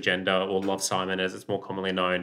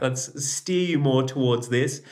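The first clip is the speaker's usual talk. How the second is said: much higher, slow and soothing; gentle redirect; 5 semitones higher, slower than usual. Retold or imitated. Son steering homophobic mum gently, higher and slow. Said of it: "I'd be like".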